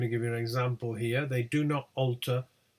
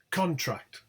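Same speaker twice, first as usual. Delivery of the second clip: "contract" stressed on the first syllable, higher pitch there; 5 semitones higher than usual. Shown as a demonstration. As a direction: hit the start of "contract", strong vowel in both syllables. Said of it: "stress here is on the first syllable".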